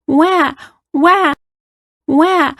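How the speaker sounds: put-on high-pitched little girl wail; mock crying. A narrator's reading. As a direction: imitate a child's wah-wah cry. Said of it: "cried the little girl".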